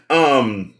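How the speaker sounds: ordinary talk, nothing marked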